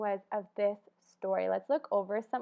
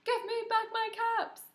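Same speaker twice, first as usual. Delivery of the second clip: much higher put-on peddler voice; higher still, demanding; peddler's demand, higher; 11 semitones higher than usual. Shown as a demonstration. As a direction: lead the class in the peddler's line. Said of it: "Can you do that with me? Ready, go".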